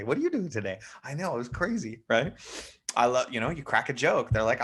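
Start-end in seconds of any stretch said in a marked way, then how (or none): 0.0-2.0 upbeat. Shown as a demonstration. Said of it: "it's usually fast and I'm upbeat".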